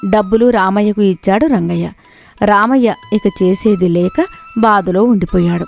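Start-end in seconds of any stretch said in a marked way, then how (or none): none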